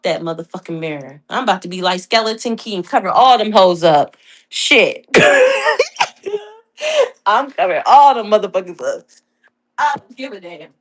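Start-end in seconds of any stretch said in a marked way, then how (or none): 5.1-6.4 high cackle. Real, not acted. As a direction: cackle at your own joke. Big laugh at own joke.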